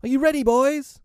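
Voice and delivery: very high, loud rallying cry; bright-spirited high shout. A narrator's reading. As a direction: call merrily to your gang in a boy's voice.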